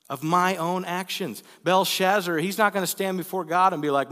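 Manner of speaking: high, loud, urgent preacher voice; preaching at full force. Real, not acted.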